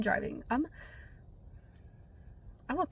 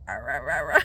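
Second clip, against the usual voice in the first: low mock monster growl; wordless low monster babble; monster-like lost voice; 9 semitones lower, 7 dB louder than usual. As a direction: imitate a lost voice as deep "rah" noises. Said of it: "a monster or something".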